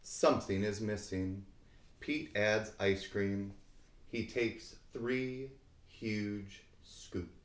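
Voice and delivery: plain reading voice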